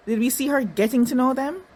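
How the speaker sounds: ordinary talk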